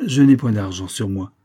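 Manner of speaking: ordinary delivery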